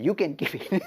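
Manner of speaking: amused, chuckling delivery; laughing quote. Retold or imitated. Ramakrishna refusing the Mother Goddess's gift, laughing.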